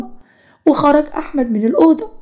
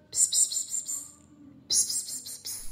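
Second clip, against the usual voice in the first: shh it under your breath; whispered shushing, hush them; soft repeated shushing whisper; 18 dB quieter than usual. Acted. Hushing someone quietly.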